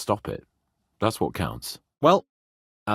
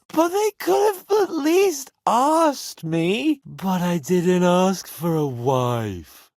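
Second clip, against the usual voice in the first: put-on slow, higher drunken complaint; drunk whine; 5 semitones higher, 3 dB louder, slower than usual. Drunk complaining about arranged marriage.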